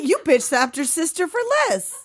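mock outrage. Co-host scolding a film character, in mock outrage. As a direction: act scandalized at them.

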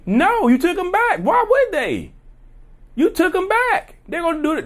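you can't believe they'd even ask; high-pitched disbelief; exasperated rant. Real, not acted.

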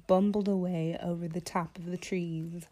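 plain reading voice

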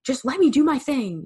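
mock frustrated whine at a micromanaging boss. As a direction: whine it like a fed-up employee. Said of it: "so frustrated with her".

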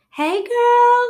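very high, loud phone greeting; cheery greeting. Retold or imitated. Sister picking up the phone, mock cheery greeting. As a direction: imitate an excited relative picking up. Sister-in-law answers her call.

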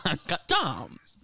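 slow mock catchphrase with laughter; imitated viral pronunciation. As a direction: drag out "Gems" in amused mimicry.

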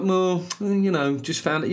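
drawn-out, high Brummie moan; defeated Brummie. Acted. Imitating Brummies after a small loss, defeated Brummie accent. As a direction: sound mildly let down in Brummie. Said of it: "Brummies always sound a little bit defeated".

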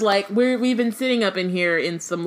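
ordinary talk, nothing marked

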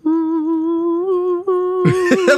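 high sung hum like a car; ghostly electric hum. Pretend. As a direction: sing a high eerie hum.

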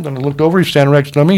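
usual speaking voice

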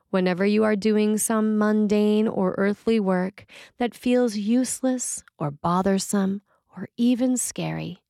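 ordinary delivery

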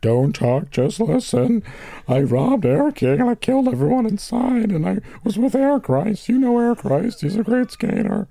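high-pitched, weepy put-on voice; mock-weepy falsetto caller; Weepy Voice Killer. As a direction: confess in a high, tearful whimper. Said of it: "we have Weepy Voice Killer on the phone".

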